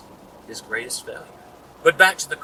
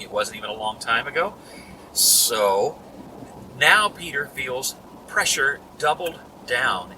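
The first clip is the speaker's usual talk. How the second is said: ordinary delivery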